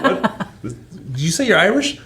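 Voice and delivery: put-on high, playful Irish accent slipping into Jamaican; mock Irish brogue drifting Jamaican; Jamaican-tinged Irish accent. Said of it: "my Irish becomes Jamaican".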